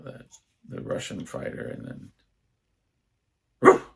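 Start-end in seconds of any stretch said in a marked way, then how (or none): 3.6-3.9 short, sudden mock bark; imitated dog bark. Dad reacting to Rocky's knockdown, imitated bark. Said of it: "And he would bark".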